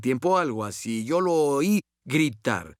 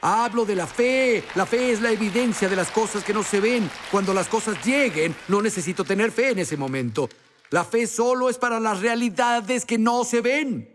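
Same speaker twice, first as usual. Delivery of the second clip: raised, emphatic pulpit voice; preaching; 5 semitones higher than usual. Acted. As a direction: preach it with conviction to the church. Preaching to congregation.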